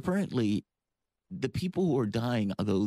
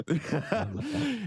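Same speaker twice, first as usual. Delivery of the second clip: short laugh; 11 semitones higher than usual. Real, not acted. Host laughs at guest's voice.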